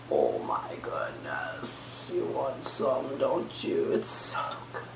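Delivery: slow, hushed, savouring whisper. Pretend. Host tempting viewers with the thick coquito, whispering.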